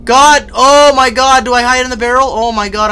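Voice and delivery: high, loud frightened outburst; scared panic. Real, not acted. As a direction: blurt it out in alarm. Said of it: "I'm scared".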